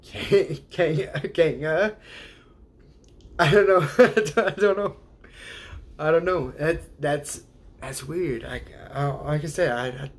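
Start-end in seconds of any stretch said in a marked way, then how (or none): 0.0-2.0 slowed, rising Kang, Kanga; slow trial of Kang; slow tries. Shown as a demonstration. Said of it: "Just say Kang". 3.4-6.5 laughing through I don't know; amused give-up. Real, not acted.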